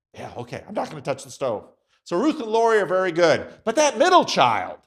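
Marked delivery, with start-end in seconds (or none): 0.1-1.7 low, soft, quick compliance; meek agreement. Acted. Youngest child answering a stern parent, meekly. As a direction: give in quietly, like an obedient child.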